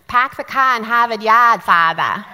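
put-on big, loud Boston accent. Coworker showing off for a priest, loud Boston accent. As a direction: belt it out in broad Boston, R's dropped. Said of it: "Say something in that beautiful accent".

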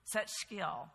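usual speaking voice

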